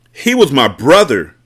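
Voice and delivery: loud, raw shout. A narrator's reading. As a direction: shout it back in grief. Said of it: "Monroe shouted".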